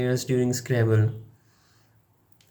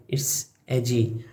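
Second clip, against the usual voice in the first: softer low vampire mutter of it's edgy; lightly voiced Berlin vampire; mock edgy vampire; 3 semitones lower, 5 dB quieter than usual. Imagining a vampire in Berlin, a soft edgy mutter. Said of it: "vampire in Berlin".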